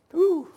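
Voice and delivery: high, short "whew" of amazement. Real, not acted. Preacher marvels at the help offered, high whew. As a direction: let out a high whooped breath. Exhales after retelling an offer.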